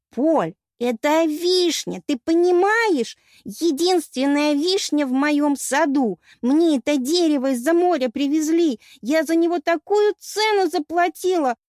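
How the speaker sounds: high, loud tearful wail; sobbing lament. A narrator's reading. Goddess mourning her broken cherry tree, loud sobbing lament. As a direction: wail out the grievance, drawing out words. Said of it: "Всхлипывала богиня".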